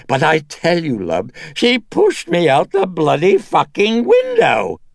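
high, loud, cracking whine; cracking and high-pitched. A narrator's reading. Dennings outraged at Karras, voice cracking high. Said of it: "The voice cracking and high-pitched".

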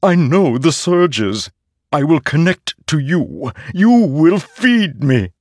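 high, slow, otherworldly hungry blade; a living sword craving to be used; eager sword voice. A narrator's reading. Nightblood the sword speaking.

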